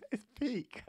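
high laugh through the words; real amused laugh. Real, not acted. Laughing at his own joke.

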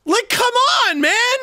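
high, loud cursing outburst; frustrated shout. Real, not acted. Player cursing a failed button press, frustrated shout. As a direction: yell at the game in exasperation. Fails the game's jump.

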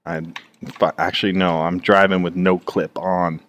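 ordinary delivery

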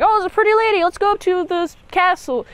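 hammed-up lovestruck knight; swooning knight. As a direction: gush over the lady in a high put-on voice. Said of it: "it's like".

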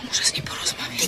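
soft determined whisper; whispered resolve. Acted. A woman whispering to her friend that she must talk to him. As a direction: whisper it, mind made up.

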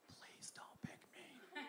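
hushed unvoiced plea; breathless whisper begging not to be picked. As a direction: whisper it so only the teacher hears. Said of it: "And I was whispering".

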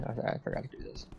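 whispered aside to himself; whispering. Real, not acted. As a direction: drop to a quick whisper. Muttered aside while playing.

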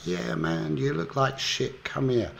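high-pitched imitation of a hearty general; jovial ribbing. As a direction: tease an old pal, pitched well up. General jovially answers Boyle.

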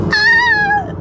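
a sudden high, loud squeal of joy; excited squeal. Real, not acted. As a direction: shriek with real excitement. Excited about meeting bestie's baby.

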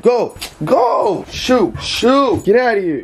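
sharp rising shoo-off; shooing the dog. Real, not acted. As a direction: chase the dog off the bed.

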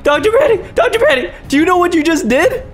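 high-pitched, panicky calling; alarmed. Real, not acted. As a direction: call out to a partner in rising alarm. Alarmed at what partner did.

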